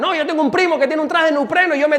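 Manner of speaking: loud, high, insistent mock bragging; hammed-up man who can handle it himself; self-sufficient men. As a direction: wave off help with overeager confidence. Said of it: "Pero los hombres somos".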